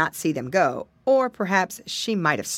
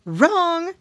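high, loud teacher; ladybug teacher voice; 12 semitones higher, 5 dB louder than usual. Acted. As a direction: snap it like a strict teacher. Teacher corrects pig.

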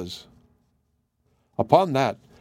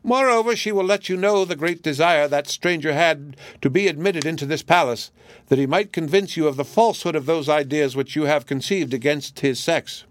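parrot voice; 5 semitones higher than usual. Acted. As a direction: prattle on like a clever bird. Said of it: "still counterfeiting the parrot's voice".

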